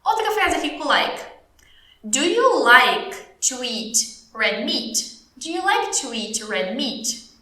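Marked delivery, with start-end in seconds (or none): 2.0-5.2 very slow English, broken into chunks; very slow model question. Shown as a demonstration. Teacher models question, then repeats. 5.4-7.3 slow but connected teacher question; slow model question. Shown as a demonstration. Teacher repeats question without breaks.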